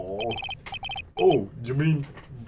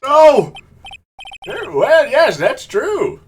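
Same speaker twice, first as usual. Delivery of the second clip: startled shout; 9 semitones higher, 6 dB louder, slower than usual. Acted. Judge flustered.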